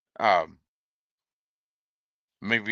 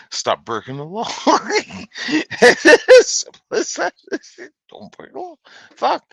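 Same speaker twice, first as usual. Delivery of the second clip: breaking up laughing mid-scolding; high, breathless laughter between phrases; 7 dB louder, faster than usual. Real, not acted. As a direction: laugh your way through the admonishment. Cracking up while scolding offenders.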